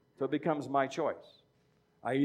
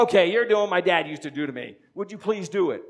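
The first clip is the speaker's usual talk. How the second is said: louder, pleading student fed up with the teasing; exasperated student; 4 semitones higher, 6 dB louder than usual. Retold or imitated. Student fed up with teasing teacher, loud and exasperated. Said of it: "someone would go".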